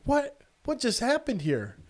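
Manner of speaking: high-pitched bewildered question; baffled surprise. Retold or imitated. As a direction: act stunned, not getting it. Baffled as boy runs off.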